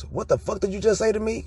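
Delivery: imitating an affronted neighborhood kid; incredulous tough guy. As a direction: play the offended dude from the block. Stranger confronts Chad for talking proper.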